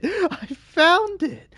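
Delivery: very high, slow drawn-out triumph; maniac shriek. Acted. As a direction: savour it like a madman. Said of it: "maniac voice".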